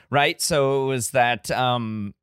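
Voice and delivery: ordinary talk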